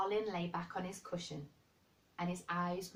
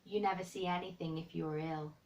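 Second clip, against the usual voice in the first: ordinary delivery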